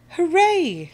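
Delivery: high, loud hooray; cheering shout. A narrator's reading. Crew saved from the storm, shouted cheer. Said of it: "shouts the crew".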